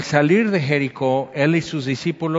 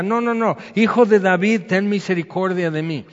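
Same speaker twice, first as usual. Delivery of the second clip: insistent calling out; 5 semitones higher than usual. Retold or imitated. Blind beggar refusing to be silenced, calls Jesus, insistent calling out. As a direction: cry out stubbornly, pitch raised.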